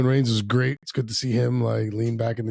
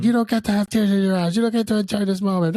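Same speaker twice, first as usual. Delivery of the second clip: higher, mock-bratty whine; whiny Rollins; 10 semitones higher, 4 dB louder than usual. Cohost mimicking Rollins taunting Punk, lightly whiny. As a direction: sneer it like a petty rival. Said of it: "he played the great, oh, bitch".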